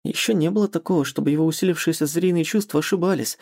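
ordinary delivery, nothing marked

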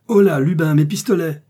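loud, high urgent call. A narrator's reading. Gentleman shouts to his valet Lubin for his pistols. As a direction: bellow for the servant to bring pistols. Nobleman calls servant for pistols.

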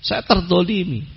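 high, soft mock plea for rescue; hushed, high-pitched imitation of a distant cry; imitated cry for help. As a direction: plead high without raising volume. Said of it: "Mereka teriak".

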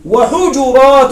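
وحجرات said wa-ḥujurāt slowly, u after the j; plural with itbaa. Shown as a demonstration. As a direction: stretch وحجرات as wa-hu-ju-rāt, echoing the first u. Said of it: "هذا مع الإتباع لحركة الفاء". (وحجرات بضم الجيم إتباعا: وحُجُرات)

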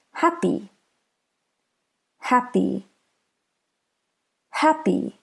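"happy" with HAP stressed; stress on first syllable. Shown as a demonstration. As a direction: say "happy" with the beat on HAP, py lighter. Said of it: "Listen to the first syllable".

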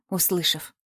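ordinary delivery, nothing marked

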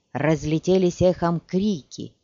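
plain reading voice

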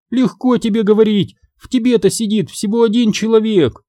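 high, aggrieved exclamation; indignant cry. A narrator's reading. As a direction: cry out in exasperation, pitch well up. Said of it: "крикнула Сабрина".

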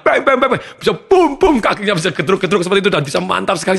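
high, laughing burst of mimicked bangs; thrilled retelling with mock booms; excited. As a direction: laugh while acting out the stomping. Excited retelling of student's speech.

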